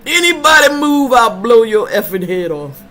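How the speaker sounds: put-on loud, high threat yelled in an African accent; movie robber shout. Quoting a movie robber to friends, shouted threat in a mock accent. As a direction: bark the hold-up line like a gunman. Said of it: "When he showed up at McDowell's".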